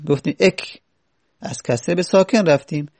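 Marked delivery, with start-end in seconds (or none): 0.4-0.8 اک as "ek", short "e" closed on a vowelless k; kasre to sakin. Shown as a demonstration. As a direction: stop اک on a bare k after "e".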